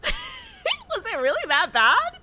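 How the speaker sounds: high, slow, laughing plea; laughing high question. Real, not acted. Laughing as she asks chat if her accent was bad. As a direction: giggle through the question.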